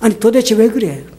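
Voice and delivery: mildly bewildered husband; startled, flustered. Retold or imitated. Husband asking his suddenly weeping wife what's wrong, flustered. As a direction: imitate someone taken aback and at a loss. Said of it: "너무나 놀라고 당황해가지고 물어본 거예요".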